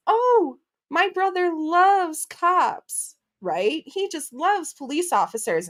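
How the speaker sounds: put-on high, slow, sing-song child voice; imitating daughter. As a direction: put on a sweet little girl voice, teasing. Daughter quoted in the story.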